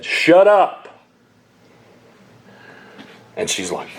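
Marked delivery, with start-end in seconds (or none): 0.0-0.9 loud, high, abrupt "Shut up"; retold shout. Pastor cutting off a friend's excuse, loud shout. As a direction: bark it to cut her off. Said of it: "and I just said".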